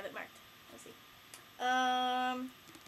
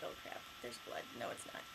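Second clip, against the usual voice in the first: hushed reaction; 13 semitones lower, 13 dB quieter, faster than usual. Retold or imitated. Acting out flinching at book.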